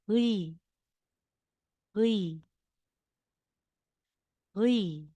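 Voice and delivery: Uy said with a smile, teeth apart; smiling Uy demo. Shown as a demonstration. Teacher shows Uy with a smile for the class, smiling demo. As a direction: spread the lips and say Uy. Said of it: "Para nakasmile ka".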